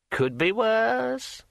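high, slow, eerie ringing voice; like a finger round a wine glass rim; ringing metallic sword voice. A narrator's reading. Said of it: "said Kring".

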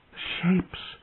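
high, hushed, breath-filled cry; a stifled scream of horror. A narrator's reading. Dany seeing shadows in the tent, screamed. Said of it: "she screamed".

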